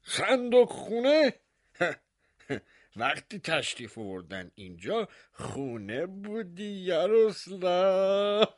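high, sarcastic, half-laughing question; mock him with teasing pity and a laugh; mocking surprise. Mayor mocking the baker about the closet, sarcastic and amused. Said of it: "تمسخر و طعنه و تعجب و دلسوزی".

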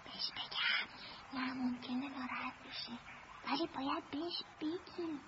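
put-on child whispering to her brother about telling Velota; secret whisper. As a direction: whisper so the grown-up can't hear. Kids conferring secretly before Velota.

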